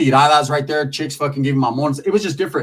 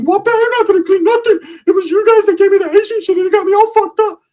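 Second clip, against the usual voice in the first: much higher, louder whiny protest; indignant Ricky voice; 7 dB louder than usual. Retold or imitated. Ricky refusing to clean up the dad's driveway, high whiny protest. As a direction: refuse in a high outraged caricature voice.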